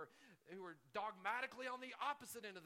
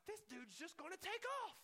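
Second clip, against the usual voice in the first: much higher, fed-up complaint; exasperated thought; 11 semitones higher than usual. Retold or imitated. Stuck driver complaining about the SUV, high and fed up. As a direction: whine that he's about to bolt. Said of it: "And then I'm like".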